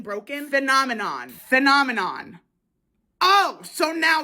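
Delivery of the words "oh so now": sarcastic. Real, not acted. Filter finally works.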